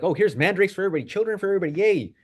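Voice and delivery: high, fast, sing-song mock generosity; mock cheerful sharing. Preacher mocking a generous Reuben, high and breezy. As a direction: rattle it off like a giddy giveaway. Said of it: "Reuben is not like".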